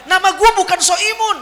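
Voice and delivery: high-pitched indignant retort; comically angry comeback; mock anger. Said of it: "Dia marah lagi".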